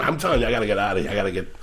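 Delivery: ordinary talk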